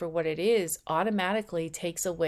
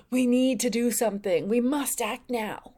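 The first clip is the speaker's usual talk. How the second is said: higher and louder, pressing urgency; a gasp and then a push to act at once; urgent ego voice; 4 semitones higher, 4 dB louder than usual. Retold or imitated. The ego boosting itself, a touch of urgent alarm. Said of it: "more external and just more like".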